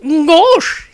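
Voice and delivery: high, loud flustered character voice; high-pitched embarrassed gasp of a word; embarrassed Maestro. A narrator's reading. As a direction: blurt it out, caught out and flustered. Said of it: "he said in embarrassment".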